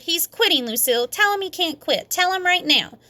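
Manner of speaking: put-on high, pushy little-girl voice; bossy tattling girl. A narrator's reading. May tattling on Sheldon to Lucille, high and bossy. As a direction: tattle and demand.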